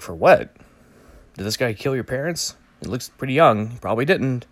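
ordinary talk, nothing marked

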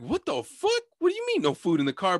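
high-pitched retold disbelief; incredulous protest. Friends refused by the Uber driver, incredulous. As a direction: question it sharply, pitch leaping up.

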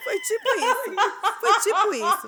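high, slow laughing agreement. Real, not acted. Storyteller laughing while agreeing with her friend. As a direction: laugh the words out.

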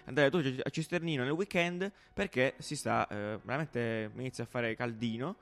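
playful weather presenter voice; weather forecast read. Acted. Mock weather forecast segment.

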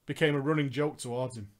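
ordinary talk, nothing marked

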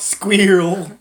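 Squirrel as "SKWEER-ul"; echoed pronunciation. Retold or imitated. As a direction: mimic the ee in Squirrel. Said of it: "My mom says squirrel".

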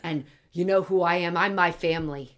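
put-on proud family boast in a New Jersey accent. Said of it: "that's a good accent".

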